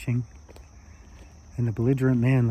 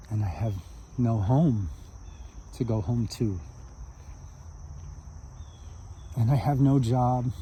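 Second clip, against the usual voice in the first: understated grief, halting with long pauses; tearful confession; 5 dB quieter than usual. Acted. Broken drunk admitting his losses to the small man, understated sorrow. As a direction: confess it quietly, letting each loss sink in. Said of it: "the tears are streaming down his face".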